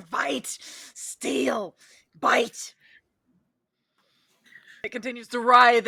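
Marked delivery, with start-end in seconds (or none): 0.1-2.7 whispered rat creature. Acted. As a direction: hiss it out in a whisper. GM voices creature thoughts.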